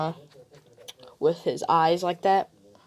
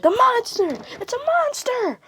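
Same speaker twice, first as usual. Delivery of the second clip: shrill, much higher and louder panicked shriek; terrified screaming; 4 dB louder than usual. Retold or imitated. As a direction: scream it like you've seen a monster. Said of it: "Screaming and crying".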